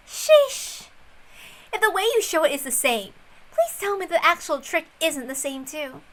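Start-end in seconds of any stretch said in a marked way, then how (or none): 0.1-0.9 a breathy, fed-up sigh; exasperated sheesh. Acted. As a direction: sigh it out, weary of the old trick. Exasperated sigh over the letter.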